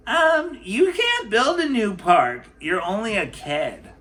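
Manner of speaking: put-on high, loud clerk voice dismissing a child; a bossy official telling a kid no. Clerk refusing the girl's park plan, in a high loud voice.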